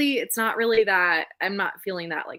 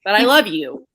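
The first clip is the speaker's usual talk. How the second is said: much louder, a burst of affection; fond outburst; 3 semitones higher, 11 dB louder than usual. Retold or imitated. Fan scolding then adoring the couple, loud fond burst. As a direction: blurt the love out loud.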